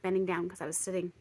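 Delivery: usual speaking voice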